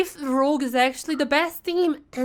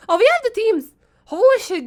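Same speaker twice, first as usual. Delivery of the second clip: excited; 9 semitones higher, 4 dB louder than usual. Real, not acted. Teams just revealed.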